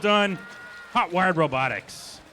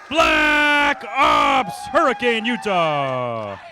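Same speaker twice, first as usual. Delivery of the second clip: slow, drawn-out, louder hype intro; fighting game announcer; 6 dB louder, much slower than usual. Acted. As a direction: stretch every syllable like a ring announcer. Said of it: "game announcer".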